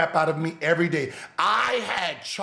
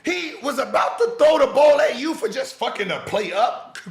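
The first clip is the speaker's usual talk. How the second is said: shouted mock disbelief, higher and louder; mock shock; 6 semitones higher, 4 dB louder than usual. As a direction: act stunned, loud and high. Host mocks shock at Oakley story.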